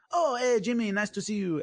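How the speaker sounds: a friendly greeting, lightly voiced in a French accent. Retelling how GSP greeted Jimmy, with a mild French accent. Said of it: "the French accent".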